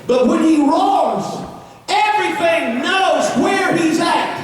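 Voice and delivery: rising preacher's shout, slow on the roar; preaching at full force. Acted. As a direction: build it like a revival preacher.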